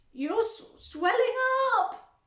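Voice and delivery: high, loud scream. A narrator's reading. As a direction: scream it again. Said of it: "screamed Missus Beauregard again".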